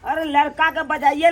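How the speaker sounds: normal talking voice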